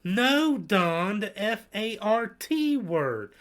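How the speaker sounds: high, loud, slow spelling of F A R T; scandalized grandma. Retold or imitated. Grandma correcting grandpa about the fart word, high and shrill. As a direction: squeal it out like a horrified grandmother. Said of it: "I heard she go".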